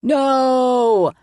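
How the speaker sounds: high, loud drawn-out no; defeated play villain. A narrator's reading. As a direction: wail it like a beaten mad scientist. Mad scientist defeated.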